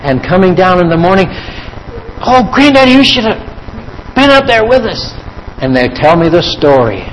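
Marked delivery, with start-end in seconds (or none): 2.2-5.1 put-on higher, eager little kid voice; excited grandchild. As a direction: gush it like a thrilled grandchild.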